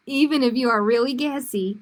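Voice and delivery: reading through a laugh; can't keep a straight face on gassy. Real, not acted. Reader laughing at the gassy page while reading to kids.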